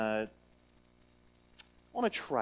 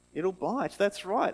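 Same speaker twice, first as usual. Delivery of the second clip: ordinary delivery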